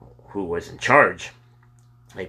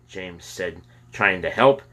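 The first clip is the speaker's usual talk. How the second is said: plain reading voice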